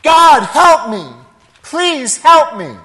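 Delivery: high, loud, drawn-out cry for help; acted desperate call, each word stretched. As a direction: plead up to heaven at the top of the voice. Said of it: "he cried out".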